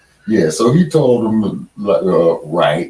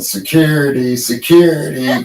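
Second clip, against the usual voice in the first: Chris Rock; 8 semitones higher, 4 dB louder than usual. Retold or imitated. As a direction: call out urgently, pitched well up, imitating a movie line. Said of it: "the movie with Chris Rock".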